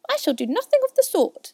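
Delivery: very high, loud indignant refusal; high-pitched mouse outrage; offended mouse. A narrator's reading. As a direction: refuse in a huffy, squeaky voice.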